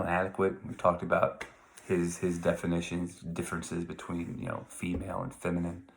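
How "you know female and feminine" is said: usual speaking voice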